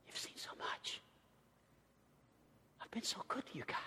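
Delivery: soft whisper. Acted. Jesus whispers his hurt to the disciples, soft.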